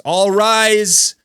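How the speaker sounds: loud, high courtroom announcement; court call to order. Acted.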